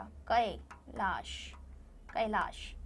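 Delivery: कैलाश with a long, open "kai" at the start; drawn-out ai vowel. Shown as a demonstration. Teacher modelling the ai sound in कैलाश for children. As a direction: stretch the ऐ in कैलाश slowly. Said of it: "ऐ की मात्रा".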